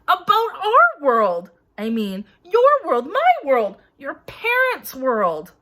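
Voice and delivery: loud, slow, insistent giant voice; emphatic giant. A narrator's reading. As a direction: hammer home each world. Hagrid explaining emphatically.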